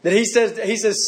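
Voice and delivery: normal talking voice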